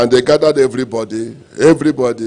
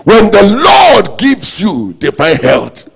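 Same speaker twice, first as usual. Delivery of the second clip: high, loud, slow drawn-out proclamation; preaching emphasis; 6 semitones higher, 5 dB louder, slower than usual. Acted. Pastor proclaiming to his congregation, loud and slow. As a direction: stretch out divine health like a sermon climax.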